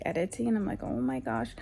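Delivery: ordinary talk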